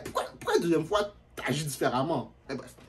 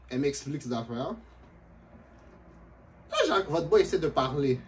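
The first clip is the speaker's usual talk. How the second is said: usual speaking voice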